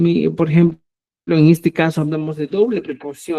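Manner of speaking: ordinary delivery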